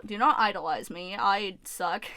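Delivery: ordinary talk, nothing marked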